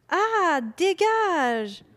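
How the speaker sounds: sudden high yelp, then shooing the fly off; real shout, surprised and annoyed; startled shout. Real, not acted. Swatting a stinging fly.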